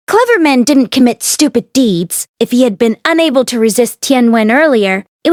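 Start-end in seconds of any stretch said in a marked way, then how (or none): none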